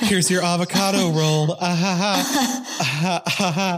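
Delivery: high, chirpy server voice with nervous laughs; bright customer-service voice. Retold or imitated. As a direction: play the overly sweet waitress laughing it off. Imitating her work voice.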